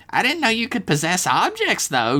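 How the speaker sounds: high, squeezed kid voice, still voiced; high curious stage whisper; mock whispering Dev. A narrator's reading. Dev asking the ghost about his powers, high and secretive. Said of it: "Dev continued to whisper".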